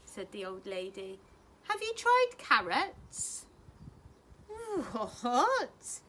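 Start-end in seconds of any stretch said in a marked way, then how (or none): 1.7-3.5 put-on high, loud, slow old lady asking; a dotty granny's cheerful question. A narrator's reading. 4.5-6.0 puzzled owlet. A narrator's reading. Plop puzzled.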